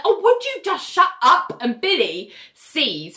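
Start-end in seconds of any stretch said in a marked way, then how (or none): 0.0-1.6 high-pitched exasperated mock yell; imitating someone who is fed up; retold loud outburst. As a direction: act out snapping at a squabbling colleague. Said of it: "Georgia says that really loudly".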